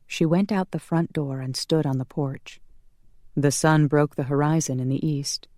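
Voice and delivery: ordinary delivery, nothing marked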